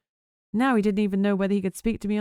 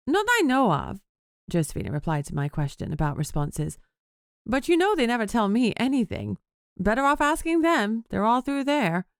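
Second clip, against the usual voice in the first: bright higher-pitched gossip voice; chirpy office lady, pitched up; high chatty woman voice; 5 semitones higher than usual. Acted. Josephina, a chatty colleague.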